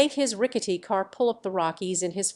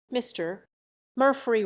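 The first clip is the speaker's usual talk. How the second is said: ordinary delivery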